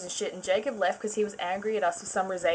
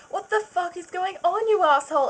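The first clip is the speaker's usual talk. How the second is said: high, loud outraged yell; hammed-up furious yell at him; mock scream; 10 semitones higher, 4 dB louder than usual. Narrator catches Edward and yells at him, high mock scream. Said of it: "I screamed".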